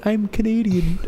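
high-pitched put-on claim; closeted American pretending. Closeted American passing as Canadian, high mock voice. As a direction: squeak out an unconvincing cover story. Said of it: "I'm a closeted American going".

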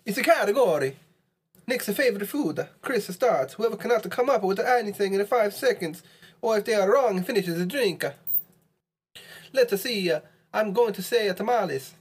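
high, sing-song mock Italian accent. Player protesting the rule to a friend in a mock Italian accent. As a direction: put on a hammy Italian accent, pitched well up. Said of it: "speak with an Italian accent".

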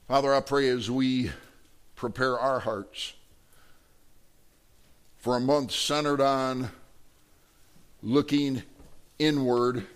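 slow, measured public prayer; closing prayer. Real, not acted. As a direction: pray aloud in short phrases with long pauses.

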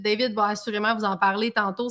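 ordinary talk

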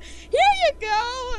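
high, slow, drawn-out presenting; giddy fan. Retold or imitated. Fan handing gifts to a celebrity, giddy. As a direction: hand it over in a high singsong. Retells handing things over excitedly.

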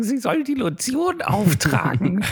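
high, slow, creepy killer voice; creepy serial killer voice. Retold or imitated. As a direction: drawl it like a sinister killer. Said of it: "Das war Odulf aus, äh, Schweigen der Lämmer".

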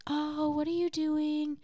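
high, soft, soothing coo; softly cooed, exaggerated sweetness; girlfriend voice. Shown as a demonstration. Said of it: "higher pitched tone that is soothing for reassurance".

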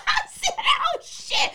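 high, loud laughing mock shriek; shocked yell. Retelling the party rumble, high mock shriek. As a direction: play up the alarm, half laughing. Retelling a sudden fist fight.

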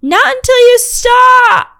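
very high, loud, slow whiny yell; bratty kid screaming a refusal; defiant yell. Acted. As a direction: wail it like a stubborn little kid. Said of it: "kid refusing, high drawn yell".

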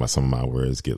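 normal talking voice

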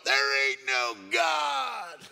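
slow, drawn-out bellow; loud heckling yell, every word stretched; neighbor's shouted retort. Acted. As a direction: holler it back across to next door. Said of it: "hear him from his living room go".

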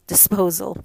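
ordinary delivery, nothing marked

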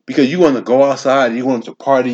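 ordinary talk, nothing marked

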